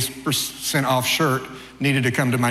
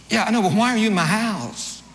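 much higher, exasperated demand; indignant homeowner; 8 semitones higher than usual. Retold or imitated. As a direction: demand why he's in their house. Homeowner confronting the speaker.

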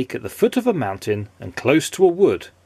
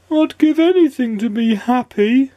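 high, squeaky and longing; high mournful yearning; sad squeaky voice; 12 semitones higher, 4 dB louder than usual. A narrator's reading. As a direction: plead wistfully, squeaky. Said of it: "A sad, squeaky sort of voice".

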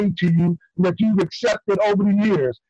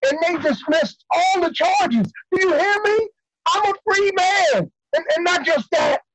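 higher-pitched, excited good news; elated; 7 semitones higher than usual. Acted. Prisoner tells partner he is cleared, elated. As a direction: burst out with joyful relief.

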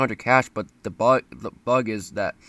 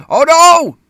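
shocked shout; 19 dB louder than usual. Real, not acted. Sudden in-game mishap, shouted.

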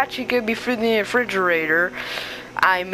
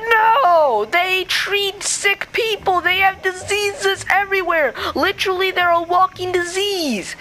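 furious rant; 6 semitones higher than usual. Acted. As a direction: yell at him like he's an idiot. Spider Chicken yells about doctors.

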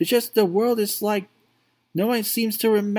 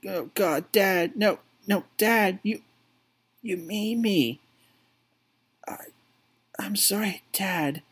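softer, fading to a whisper; grief-stricken; 4 dB quieter than usual. Acted. Son over his dead father, fading to a whispered apology. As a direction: break down quietly over him.

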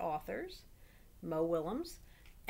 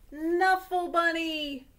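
put-on high, loud, slow toddler cry; toddler's first words; 8 semitones higher, 8 dB louder, much slower than usual. Trixie shouts her first words at the laundromat, high and slow. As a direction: stretch it out like a toddler's first word. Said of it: "the first word Trixie ever said".

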